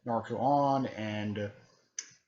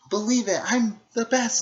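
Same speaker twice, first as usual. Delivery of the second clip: cocky Naruto; 12 semitones higher, 8 dB louder than usual. Retold or imitated. Naruto boasting to the villains, high and loud. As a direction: brag like a brash young ninja. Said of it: "she's like, uh".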